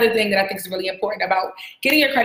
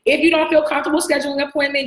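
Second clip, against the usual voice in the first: normal talking voice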